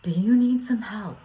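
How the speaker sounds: retold yell. Neighbour yelling out the window to a man in the street, retold. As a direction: call out loud and high. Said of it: "I yell out the window".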